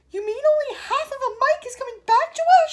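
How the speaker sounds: very high, loud cry; cried. A narrator's reading. Mother crying out about her son. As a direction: cry out in horror. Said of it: "she cried".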